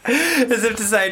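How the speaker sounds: high, laughing lead-in; giggling. Real, not acted. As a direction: laugh through it. Laughing while retelling.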